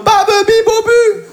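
put-on high, slow baby babble; mock babbling. Comedian imagining himself after ten sessions, high slow babble. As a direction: babble like a toddler. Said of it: "J'arriverai en mode".

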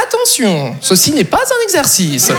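slow emergency announcement, drawn out; inner alarm voice. Acted. Little voice in his head, slow alarm announcement. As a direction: announce it slowly like an alert system. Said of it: "une petite voix qui te fait".

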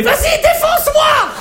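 put-on very high, slow girly shout; mock girlfriend. Girlfriend in bed demands it rough, high slow shout. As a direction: drag it out like a wild lover. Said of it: "elle me fait".

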